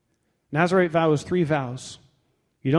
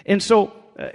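usual speaking voice

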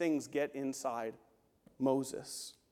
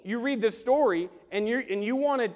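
ordinary delivery

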